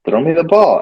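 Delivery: mock-emphatic call to throw the ball; called-out repeat. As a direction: call it out so they finally hear. Repeats louder after "What?.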